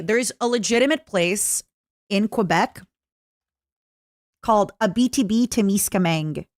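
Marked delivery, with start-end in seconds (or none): none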